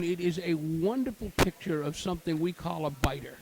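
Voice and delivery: ordinary delivery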